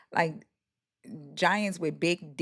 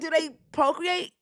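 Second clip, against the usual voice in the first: incredulous; 9 semitones higher than usual. Retold or imitated. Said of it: "I was like".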